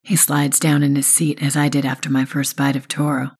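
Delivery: ordinary delivery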